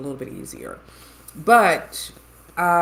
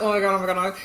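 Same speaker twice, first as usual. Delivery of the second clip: louder reenacted nervous chant; rapid mock-panicked mantra, teeth shut; through the teeth; 3 semitones higher, 4 dB louder, much faster than usual. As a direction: keep panicking through a clenched jaw, half laughing. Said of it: "a whole conversation through the teeth".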